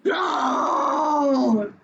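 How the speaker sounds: fierce mock roar; teacher voicing an angry lion for the quiz. Shown as a demonstration. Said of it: "and he roars like this".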